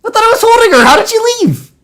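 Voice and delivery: high-pitched baffled squawk; indignant, high-voiced protest; incredulous outcry. Real, not acted. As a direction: cry out in high disbelief. Mock outrage at the game.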